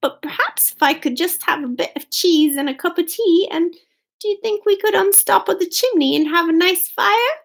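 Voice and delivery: quick, high mouse voice; eager high-pitched pleading; hopeful mouse. A narrator's reading.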